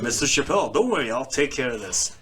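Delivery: mock proper American authority figure.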